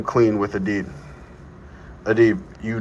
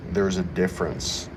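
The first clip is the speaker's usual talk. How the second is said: normal talking voice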